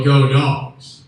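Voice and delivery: loud rising fan yell; yelling go dogs. Retold or imitated. Tubman chased by dogs, yelling the cheer loud. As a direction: belt it like a ballgame cheer. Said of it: "getting chased by dogs to yell".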